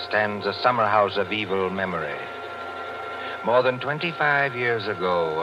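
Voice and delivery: usual speaking voice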